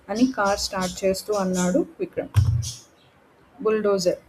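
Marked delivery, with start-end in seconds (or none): none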